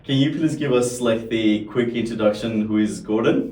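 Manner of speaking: loud, upbeat host opening; bright podcast welcome; podcast host intro. Acted. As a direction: welcome the listeners with energy.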